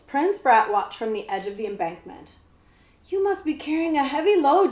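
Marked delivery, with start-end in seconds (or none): 3.1-4.7 called out loud and high; cried out. A narrator's reading. Boy calling up to the coachman, loud and high. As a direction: shout it up to the coachman. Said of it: "Jemmy cried out".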